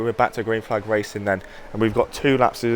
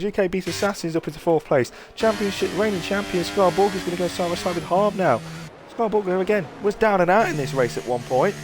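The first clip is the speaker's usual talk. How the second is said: excited live race call, pitched up; motorsport commentator; 5 semitones higher than usual. Acted. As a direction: call the overtakes with rising energy.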